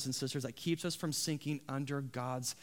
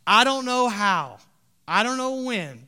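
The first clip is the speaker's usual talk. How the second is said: loud, high preacher's cry; impassioned preaching; 5 semitones higher, 11 dB louder than usual. Acted. Preacher voicing a man's unlikely hope, impassioned.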